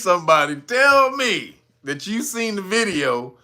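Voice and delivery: ordinary talk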